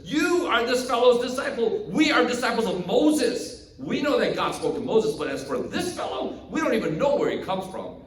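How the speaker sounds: high, indignant disdain; hurled insult. Acted. Pharisees insulting the healed man over his loyalty, indignant. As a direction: throw it at him with contempt. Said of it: "they hurled insults at him and said".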